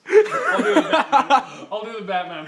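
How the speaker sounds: laughing through the words. Real, not acted. Narrator laughing as he refuses the Batman voice. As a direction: giggle while refusing the voice. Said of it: "I'm not gonna do the Batman voice".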